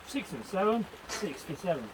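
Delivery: a hint of a bingo caller call; lightly voiced bingo number call. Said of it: "you're going too fast".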